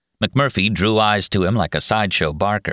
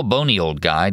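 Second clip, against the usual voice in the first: ordinary delivery, nothing marked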